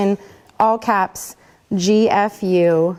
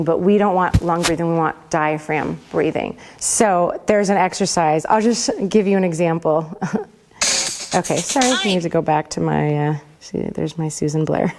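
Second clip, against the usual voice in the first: ordinary talk, nothing marked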